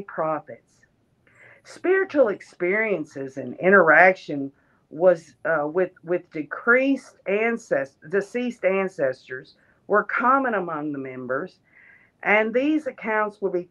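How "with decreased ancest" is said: ordinary delivery, nothing marked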